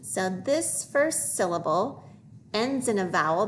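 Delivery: usual speaking voice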